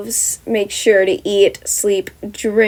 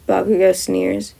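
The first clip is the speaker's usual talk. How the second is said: ordinary delivery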